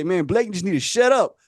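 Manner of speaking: usual speaking voice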